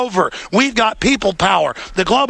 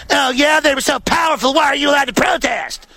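higher, mock-shouted sarcasm; mocking sneer; 5 semitones higher than usual. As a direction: jeer it like a smug reporter. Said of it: "they're like".

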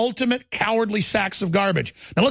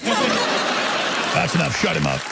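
laughing; much slower than usual. Real, not acted. Laughing at the clip.

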